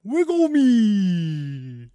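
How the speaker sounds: very slow, drawn-out high cry falling away; hammy, long-held plea; silly character voice. Shown as a demonstration. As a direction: stretch each word into a long comic wail. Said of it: "The two voices of [name]".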